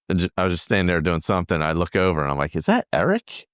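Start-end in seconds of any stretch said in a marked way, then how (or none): 2.6-3.5 rising, high-pitched incredulous question; retold double take, voice lifting sharply; can it really be him; surprised recognition. Retold spotting of a friend.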